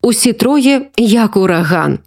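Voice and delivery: usual speaking voice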